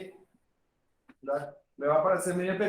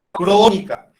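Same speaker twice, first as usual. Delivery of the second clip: "crónica" punched much louder, then falling; emphatic stress; 2 semitones higher, 11 dB louder, slower than usual. Real, not acted. Lecturer to students, stressing "crónica" loudly. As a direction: hit "crónica" hard and let it fall.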